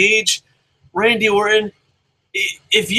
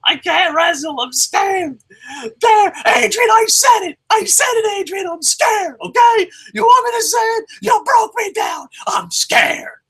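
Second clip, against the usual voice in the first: much higher, shouted whiny panic; mock cowering wrestler; 4 dB louder than usual. Wrestler screaming his fear at Adrian, hammed-up high yell. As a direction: ham up a terrified man screaming for Adrian. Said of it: "and he's like".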